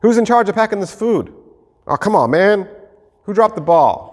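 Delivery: high-pitched mock blame; bickering. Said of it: "Bickering back and forth".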